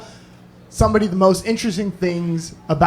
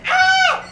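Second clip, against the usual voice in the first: high mock scream again; woman's scream. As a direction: shriek high like a startled woman. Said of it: "that's how loud it was".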